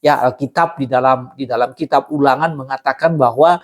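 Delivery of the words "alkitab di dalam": ordinary talk, nothing marked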